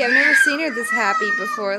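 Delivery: real tears of joy breaking through; tearful joy. Real, not acted. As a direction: voice catching with happy tears. Mother overwhelmed watching happy baby.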